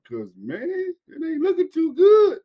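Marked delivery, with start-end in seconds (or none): 1.1-2.4 mock despair. Real, not acted. Lament about teams.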